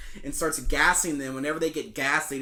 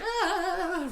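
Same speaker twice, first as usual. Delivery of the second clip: mock scream. Queen running away scared.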